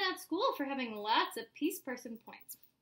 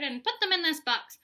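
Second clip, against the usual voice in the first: louder, higher command; ordering; 4 semitones higher, 7 dB louder than usual. A narrator's reading. Mom orders Spencer to fill the box, commanding. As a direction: lay down the law firmly. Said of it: "she ordered".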